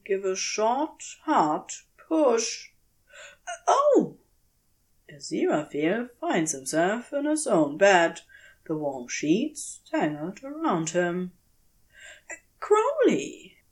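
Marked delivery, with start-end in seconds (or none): 3.7-4.2 sudden high startled cry; jolted awake, gasp it out; a sharp surprised "oh". Acted. Pushed awake from dream. 12.6-13.6 high calling out of a name; startled call. Acted. Calls out on waking.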